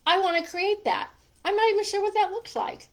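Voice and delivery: high and loud with sudden inspiration; eager burst, words tumbling fast; excited. Retold or imitated. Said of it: "I'm like".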